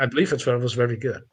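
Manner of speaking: usual speaking voice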